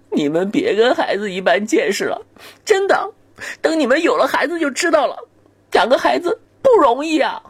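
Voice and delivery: high, pleading parent's wail; whining parent. Acted. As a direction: beg on the kid's behalf, half crying. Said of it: "家长一边哭一边说".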